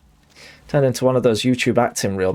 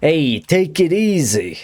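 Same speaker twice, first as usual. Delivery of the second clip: higher, slow, drawn-out mob boss calming someone down; a slow, raised Tony Soprano "take it easy"; 3 semitones higher than usual. Retold or imitated. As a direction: play the big mob boss telling someone to chill. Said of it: "if it was Tony Soprano".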